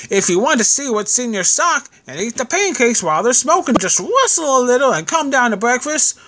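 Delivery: high-pitched landlady calling up the stairs; shouting landlady. A narrator's reading. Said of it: "shouted our landlady".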